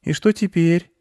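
put-on high-pitched boy voice; curious little boy; questioning boy. A narrator's reading. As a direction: ask it up high like a child.

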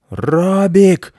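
high, loud call from indoors; irate owner shouting; angry yell. A narrator's reading. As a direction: bellow the dog's name crossly. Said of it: "сердито заорал из дома".

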